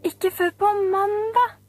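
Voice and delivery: put-on high, whiny squeak of disappointed children. Children protesting to the teacher, high squeaky whine. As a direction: peep it out like a class of kids. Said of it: "pep alle barna".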